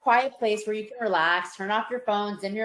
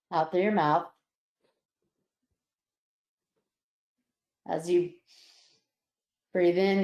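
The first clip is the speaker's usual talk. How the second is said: guided relaxation; 3 semitones lower than usual. Acted. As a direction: ease them into calm with long pauses. Leads a relaxation breathing exercise.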